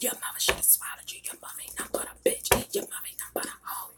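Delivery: fast, breathy whispered tirade; ASMR whisper scolding a troll; whispered cussing. Shown as a demonstration. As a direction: cuss them out in a hush. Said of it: "let me give an example".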